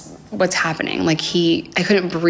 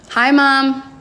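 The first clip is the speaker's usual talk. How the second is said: higher-pitched call to mom; 7 semitones higher than usual. Real, not acted. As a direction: call out a greeting to someone off in the distance. Calls out to mother mid-stream.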